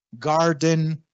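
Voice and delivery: GARDEN with a plain hard 'ga'; slow GARDEN, no 'y' glide; English pronunciation. Shown as a demonstration. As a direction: say GARDEN as 'gar-den'. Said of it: "Las palabras empezadas con G".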